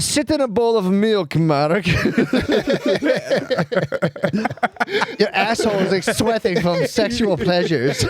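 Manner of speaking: high mock-French villain voice. Parent ordering son Mark into milk, high French-villain voice. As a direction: scold like a hammed-up French villain. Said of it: "I heard the French villain in that one".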